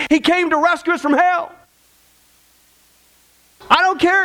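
high, driving pulpit climax; rousing preacher at full force; full-force preaching. Acted. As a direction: preach it up, building to the peak.

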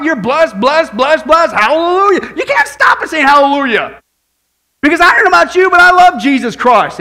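loud, high fervent preaching; full-force preaching. Acted. Pastor rousing the congregation about the wedding supper, preaching loud. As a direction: preach it hard and joyful.

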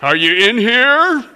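put-on slow, loud sing-song call to a hiding kid; mock-innocent parent. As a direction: pretend not to see the foot in the closet. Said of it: "What do you say?".

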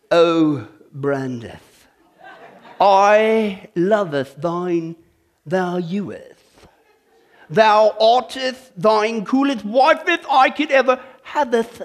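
slow, hammed-up olde English wooing; mock Shakespearean. Pastor wooing his wife in mock Shakespearean. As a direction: ham up archaic love talk to the wife, drawn out. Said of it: "that's a little too Shakespearean".

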